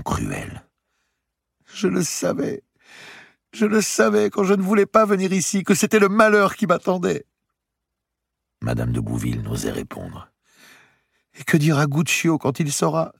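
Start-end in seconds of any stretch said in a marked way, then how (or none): 1.7-7.3 much higher, building louder lament; anguished young woman. Acted. Marie lamenting her fate, much higher and louder. 11.4-13.1 much higher, pleading; distraught young woman. Acted. Marie asking what Guccio will say, higher distraught voice.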